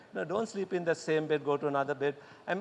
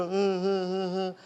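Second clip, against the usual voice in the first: looking-down sobbing imitation "uh, uh"; mock child crying; slower than usual. Shown as a demonstration. Teacher imitating a crying child, mock sobs. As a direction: sob like a small child. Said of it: "They go".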